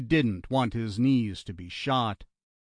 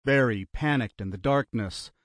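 usual speaking voice

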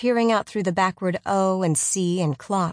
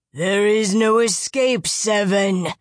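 slow, loud monster call; giant crying out; 2 semitones higher, 5 dB louder, slower than usual. Acted. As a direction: boom it out like a giant. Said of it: "the giant cried again".

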